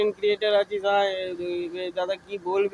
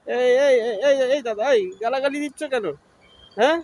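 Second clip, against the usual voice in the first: higher-pitched, indignant challenge; indignant telling-off; annoyed protest; 4 semitones higher, 3 dB louder than usual. Real, not acted. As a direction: cut in and demand an explanation, annoyed. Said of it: "রাগ করছো কেন?".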